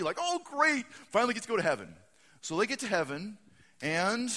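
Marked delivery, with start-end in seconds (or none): 0.2-1.9 high, loud relieved delight; happy. Acted. Packers fan finally going to heaven, happy and loud. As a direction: exclaim with joy, settling a little. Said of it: "He was all happy".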